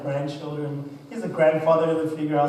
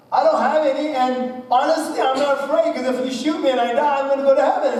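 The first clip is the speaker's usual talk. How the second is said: high, loud, fearless retort; loud, high, unafraid reply; defiant retort; 8 semitones higher, 6 dB louder, faster than usual. Retold or imitated. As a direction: fling it back at the robber without fear.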